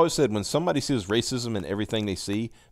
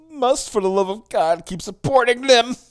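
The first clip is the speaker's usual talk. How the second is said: higher-pitched hammed-up begging; mock pleading; 10 semitones higher, 4 dB louder than usual. Reading out the author's plea for bookstores, mock pleading. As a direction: implore them in mock desperation, climbing high.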